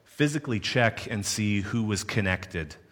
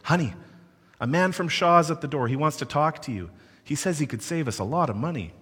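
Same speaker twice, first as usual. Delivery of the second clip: higher, louder call to her husband in the back; a retold holler down the hall; wife calling her husband from the back of the house; mock yelling; 7 semitones higher, 4 dB louder than usual. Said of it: "I hear her yelling".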